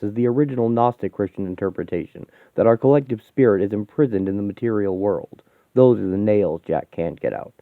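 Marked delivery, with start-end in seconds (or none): none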